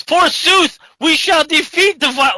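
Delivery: high, loud, mock-archaic battle cry; over-the-top paladin. Paladin stands up at the table, bombastic and heroic. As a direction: declaim it like the nerdiest role-player. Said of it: "it's so over the top".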